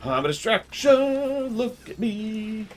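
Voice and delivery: very high, slow silly squeak; squeaky high voice. Pretend. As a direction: squeak it out like a cartoon. Same words, squeaky take.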